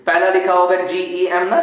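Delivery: usual speaking voice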